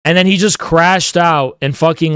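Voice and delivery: ordinary delivery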